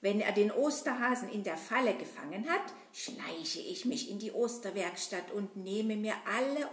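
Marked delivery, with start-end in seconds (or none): none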